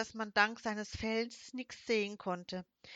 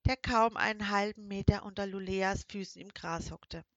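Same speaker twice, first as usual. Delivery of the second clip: usual speaking voice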